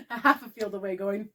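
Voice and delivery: ordinary talk, nothing marked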